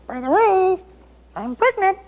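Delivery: high falsetto woman's voice, held flat; woman telling the king she's pregnant, in a mock high voice; Bathsheba's falsetto. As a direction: announce it sweetly in a high voice. Preacher voices Bathsheba's news.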